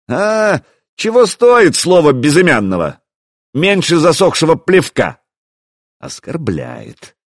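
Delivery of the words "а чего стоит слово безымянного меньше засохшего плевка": high, raised jeer; scornful shout. A narrator's reading. Prince insulting the nameless hero, scornful shout. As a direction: jeer across at a nobody.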